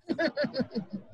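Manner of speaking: breathy laugh without sound; silent laugh. Pretend. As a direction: laugh with only air. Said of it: "can you laugh without sound".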